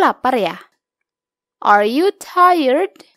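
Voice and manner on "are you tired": slow model reading. Shown as a demonstration. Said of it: "Gunakan kalimat tanya berikut".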